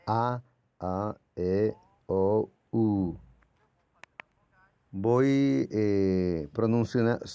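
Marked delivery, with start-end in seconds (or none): 0.1-3.2 A, A, E, O, U said one by one, mouth closing a little more each time; vowels demonstrated. Shown as a demonstration. Teacher sounding vowels for students, mouth slowly closing. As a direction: sound out A, A, E, O, U separately, open to closed. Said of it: "Y ahí voy regulando".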